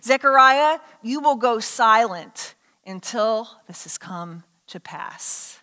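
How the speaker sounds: slow, solemn sentence fading to a whisper; grave, drawn-out decree; angel's pronouncement. Acted. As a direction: pronounce it slowly, sinking low and hushed. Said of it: "he tells him".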